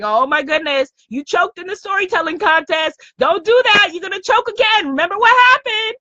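inner fourth grader. Retold or imitated. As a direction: let the scolding kid voice rush up in pitch. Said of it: "That fourth grader saying".

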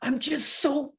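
put-on high, slow whiny kid voice; dad imitating his crying daughter, high and drawn out; mimicking upset daughter. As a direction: play his twelve-year-old sulking slowly. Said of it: "She's like".

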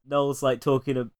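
usual speaking voice

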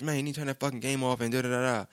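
normal talking voice

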